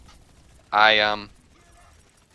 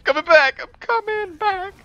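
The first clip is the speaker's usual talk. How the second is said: very high, playful squeaky repeat; silly squeak. Pretend. Player returning to the fight, silly high squeak. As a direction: pipe it up like a tiny creature.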